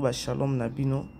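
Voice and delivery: ordinary talk, nothing marked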